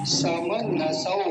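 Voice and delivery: समनसौ drawn out, final सौ with a clear au; slow demonstration. Shown as a demonstration. Teacher showing students the au ending, slowly. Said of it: "औ की मात्रा है".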